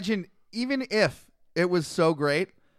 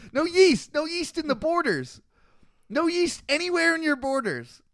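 mock proclamation; 10 semitones higher than usual. Mock chanting yeast rule.

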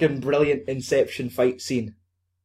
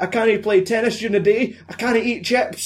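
high, exaggerated Scottish complaint; comic Scottish lament; most Scottish accent; 8 semitones higher than usual. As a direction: moan it in broad Scottish, pitched up. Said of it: "in the most Scottish accent".

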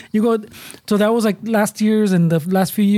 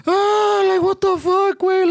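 put-on much higher, whiny kid's voice, a different timbre; imitating a kid; 12 semitones higher, slower than usual. Kid reacting to the elf prank, in a high mock-outraged voice. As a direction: squeal it like an outraged little kid. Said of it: "the kids would be like".

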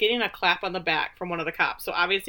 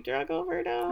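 very high, soft disbelief; high-pitched appalled query; incredulous echo; 11 semitones higher, 5 dB quieter than usual. Retold or imitated. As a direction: question it in squeaky disbelief.

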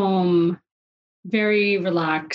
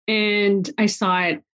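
normal talking voice

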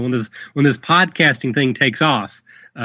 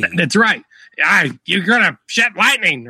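gruff old trainer voice, hammed up; bad Burgess Meredith impression; 6 semitones higher than usual. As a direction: growl it like a boxing coach. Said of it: "that was awful".

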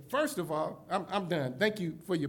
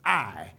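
"I" dropped much lower and leaned on; emphatic I; 12 semitones lower than usual. Shown as a demonstration. Preacher showing Joseph's emphatic "I", stressed low. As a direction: stress the single word "I" as personal. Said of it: "It's an emphatic".

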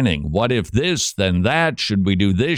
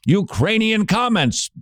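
higher Scottish brogue, mock listener question; Scottish accent; 10 semitones higher than usual. Host voicing a listener's complaint, still in a Scottish accent. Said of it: "using your Scottish accent".

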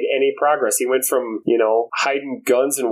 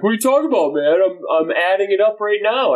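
retold indignant protest; 7 semitones higher than usual. Acts friend's indignant protest.

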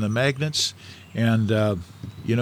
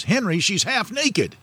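high-pitched, shocked wife's voice, imitated; scandalized mother; 9 semitones higher than usual. Mother scolds dad over a passing lady, high-pitched. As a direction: mimic a mother scolding her husband in a pitch well above his own. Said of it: "my mother turns to my dad and says".

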